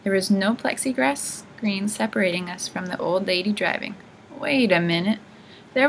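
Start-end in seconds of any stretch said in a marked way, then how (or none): none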